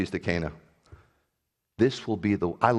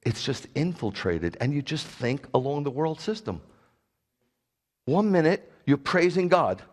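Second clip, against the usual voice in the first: normal talking voice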